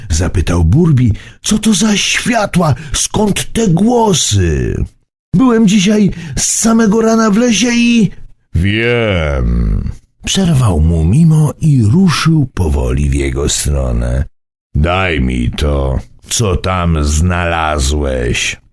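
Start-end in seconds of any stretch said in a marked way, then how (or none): 1.4-8.1 put-on high, eager child voice; a curious boy with a rushed finish; young boy voice. A narrator's reading. Boy Burbi asks the old man, high and eager. 8.5-18.6 deep, slow stern elder; a low, slow command; low old man voice. A narrator's reading. Old Mimo demands the rose from the boy, low and slow.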